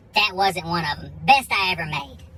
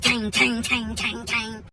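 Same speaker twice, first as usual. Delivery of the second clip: ringing, evenly repeated hammer strikes; imitated hammer clanging; 3 semitones higher, much slower than usual. Drunk husband banging on garage door, imitated clangs. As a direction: mimic metal being banged over and over. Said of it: "beating on this thing with a hammer".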